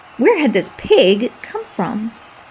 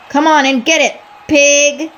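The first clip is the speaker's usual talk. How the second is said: louder, higher wrestler taunt; taunting cry; 5 semitones higher, 6 dB louder than usual. Acted. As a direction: goad the pig. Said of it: "Jorge Garcia cried".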